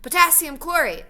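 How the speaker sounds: high, loud excited call; excited shout. A narrator's reading. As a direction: shout out each chemical as it bursts. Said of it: "he shouts".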